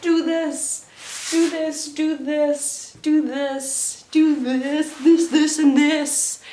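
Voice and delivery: retold posing instructions. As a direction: rattle off pose after pose, building. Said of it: "she's like".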